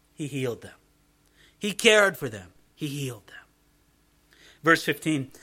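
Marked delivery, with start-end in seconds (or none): 2.7-3.4 hushed unvoiced whisper of the refrain. Shown as a demonstration. Preacher repeats the line to his congregation in a whisper. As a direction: breathe it out in a whisper.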